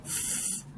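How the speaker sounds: f said unvoiced, just a hiss of air; unvoiced f sound. Shown as a demonstration. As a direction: breathe out an f. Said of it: "the f sound".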